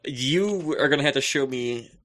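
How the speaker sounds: usual speaking voice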